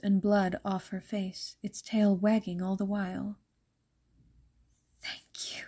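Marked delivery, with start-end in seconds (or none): 5.0-5.6 soft grateful whisper. A narrator's reading. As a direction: breathe the thanks out almost silently. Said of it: "she whispered".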